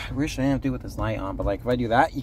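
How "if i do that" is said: ordinary delivery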